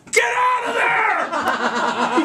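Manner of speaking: very high, slow drawn-out yell from afar; high drawn-out sideline yell; yelling friend. Retold or imitated. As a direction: shout it across the field. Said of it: "He's yelling from the sideline".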